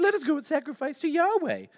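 very high, loud mimicry; mock their request in a silly high voice; exaggerated, jeering falsetto imitation; Pharaoh's mocking voice. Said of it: "I imagine in my head he said it in that voice".

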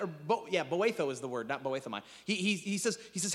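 ordinary talk

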